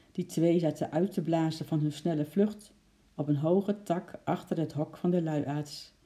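ordinary delivery

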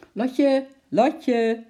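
high, loud call from a distance. A narrator's reading. Uncle calling the girl from afar, loud. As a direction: shout her name across the zoo. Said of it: "riep opeens een zware stem".

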